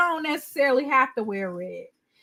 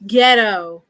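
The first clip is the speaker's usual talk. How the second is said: louder, scornful one-word put-down; scornful verdict; 2 semitones lower, 6 dB louder than usual. Real, not acted. Streamer judging the computer, louder scornful put-down.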